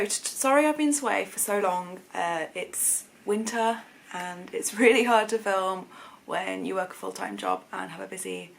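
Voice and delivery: usual speaking voice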